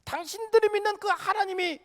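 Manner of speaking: high-pitched, insistent challenge; challenging doubter. Acted. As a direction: press the question as an acted-out skeptic. Acting out scoffers challenging believers' God. (높은 어조로 따져 묻는 말투)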